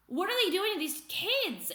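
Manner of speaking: ordinary talk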